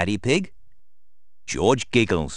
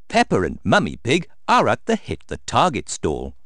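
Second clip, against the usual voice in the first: plain reading voice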